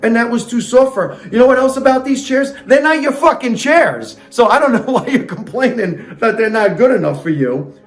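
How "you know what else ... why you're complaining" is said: high-pitched exasperated rant; indignant scolding. Real, not acted. Comic reader telling off Goldilocks over the chairs, indignant scolding. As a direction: scold in a raised pitch, fed up.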